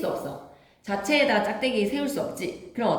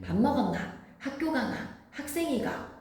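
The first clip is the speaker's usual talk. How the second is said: Gyeongsang questions pitched high, dropping away on 학생이가; teacher runs through dialect questions in a higher, softer voice; 5 semitones higher, 5 dB quieter than usual. Shown as a demonstration. 교사가 학생들에게 경상도 질문들을 시범: 높은 사투리 억양. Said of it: "다 아로 끝납니다".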